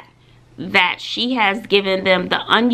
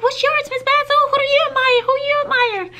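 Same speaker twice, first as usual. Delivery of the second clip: put-on much higher, pestering kids' voices; eager students; faster than usual. Students pestering their teacher for her answer, high and eager. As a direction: beg like curious students. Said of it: "everybody, they was like".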